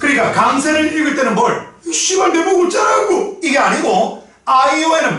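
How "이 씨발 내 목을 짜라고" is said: high-pitched, hammed-up outburst; mock defiant yell. Teacher acting out the wrong idea of stress for students, mock yell. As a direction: cry it out high, as a joke example. Mocks how not to read stress.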